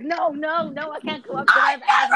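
mock panicky whine. Teasing Max about his asthma, in a mock panicky whine. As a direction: parody a scared friend pleading. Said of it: "He's like, he's like".

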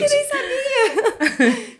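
high, slow, through tears of laughter; laugh-crying the words. Real, not acted. Laughing hard.

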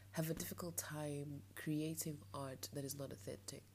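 normal talking voice

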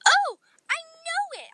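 high, loud burst of an idea; excited. A narrator's reading. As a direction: exclaim it excitedly. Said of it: "I said excitedly".

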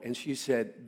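ordinary talk, nothing marked

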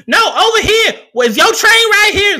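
high, loud imitation of a yelling station worker; yelling Amtrak worker. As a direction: holler directions across the platform.

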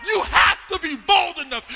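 loud, high, shouted sermon climax; preacher shouting the dare to the congregation; preaching at full force. Acted. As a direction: preach the crowd up to a roar.

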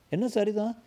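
high, sharp indignant echo; indignant retort. Acted. Man to a dismissive neighbour, high and indignant. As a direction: throw the word back in outrage.

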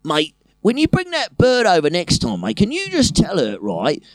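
earnest Cockney flatmate asking a favour; light Cockney. Retold or imitated. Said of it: "he'll quite often go".

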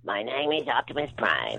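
small kid attempting a robot hero voice; childish Optimus Prime. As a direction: imitate a five-year-old's Optimus Prime. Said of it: "he sounded more like".

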